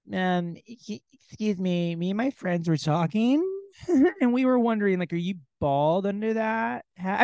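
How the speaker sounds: high, hammed-up ditzy woman; mock woman's voice. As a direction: play a woman mocked as silly. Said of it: "this is how all women sound in my head".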